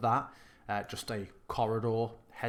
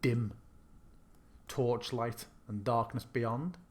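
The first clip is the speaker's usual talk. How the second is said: scene narration; 4 dB quieter than usual. Acted. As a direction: set the scene like a game master. GM describing the castle.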